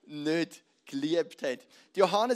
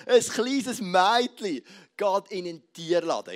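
slow, louder theatrical storyteller; 3 semitones higher, 8 dB louder, slower than usual. Shown as a demonstration. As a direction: ham it up like a stage actor. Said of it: "tönt noch ein bisschen theatralischer". (theatralisch, langsam und lauter)

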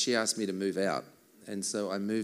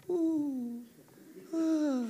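high cooing ooh and ah; baby cooing. Retold or imitated. Imitating a baby's coos for the audience. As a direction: coo like a contented baby.

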